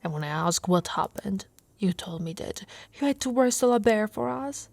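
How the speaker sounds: ordinary talk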